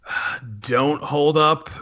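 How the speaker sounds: ordinary talk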